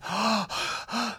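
sharp frightened gasp; caught breath. A narrator's reading. Frightened husband alone in the dark, gasping. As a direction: suck in air in fear. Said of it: "he caught his breath".

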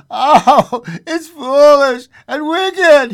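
high, loud, slow anguished protest; faltering. A narrator's reading. Husband resisting his wife's command, faltering loudly. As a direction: falter in distress, stretching the words. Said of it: "he faltered".

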